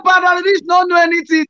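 shouting the retold reply up high.

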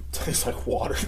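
slow, breathy laugh through the words; chuckling, mocking the review. Real, not acted. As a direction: crack up as you repeat it. Laughs rereading the review line.

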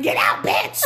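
high, loud, breathy cat yell. Retold or imitated. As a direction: squawk it like an angry cat. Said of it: "his cat in the background yelling".

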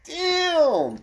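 high, loud, drawn-out exclamation; hammed-up amazement. Friend reacting to a TV witch, exaggerated amazement. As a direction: whoop it in mock awe.

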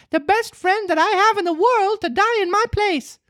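very high puppet voice, noble; brave words, piercing. Acted. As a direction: offer your life bravely. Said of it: "said in a piercing voice".

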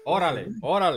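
a hint of a Mexican accent on "Órale, órale"; lightly voiced Mexican buddy; mild Mexican impression; Mexican slang. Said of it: "Con un mexicano".

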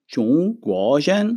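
צ'ונג גו ז'ן said slowly with clear Mandarin tones, rising on ז'ן; slow Chinese demo. Shown as a demonstration. Teacher modeling Chinese for the students, slow. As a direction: model צ'ונג גו ז'ן syllable by syllable, ending on a rise. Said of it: "לדוגמה".

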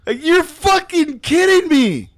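slow, high disbelief; can't believe what he's hearing; incredulous. Retold or imitated. Telling his friends, slow high disbelief.

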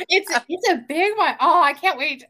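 high, loud, laughing delight; thrilled, pitched well up; real excitement. Real, not acted. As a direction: burst out giggling with eager anticipation. Thrilled guest invited back.

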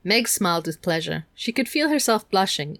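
ordinary delivery

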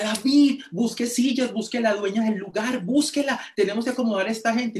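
órdenes urgentes en voz muy aguda; emocionada y desesperada. Retold or imitated. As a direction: apremia a gritos, desbordada por la emoción. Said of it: "Lina estaba emocionada, desesperada". (imitando a una organizadora desesperada y eufórica)

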